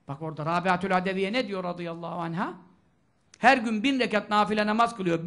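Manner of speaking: usual speaking voice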